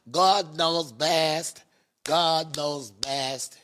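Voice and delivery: drawn-out, solemn resignation; very slow. Real, not acted. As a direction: stretch every word slowly.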